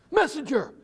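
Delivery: lightly voiced messenger calling out; a hint of an urgent rider. A narrator's reading. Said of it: "a man's voice said".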